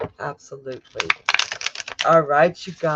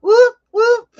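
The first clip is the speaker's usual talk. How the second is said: much higher and louder, straining whoops; high notes attempt; 12 dB louder than usual. Shown as a demonstration. Host trying high notes for a donation, straining whoops. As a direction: reach for a note past your range. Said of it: "I can't do the high notes".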